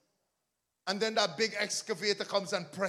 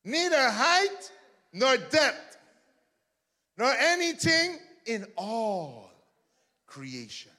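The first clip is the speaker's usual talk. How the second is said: preaching; 6 semitones higher, 7 dB louder, much slower than usual. Real, not acted. Back to preaching scripture.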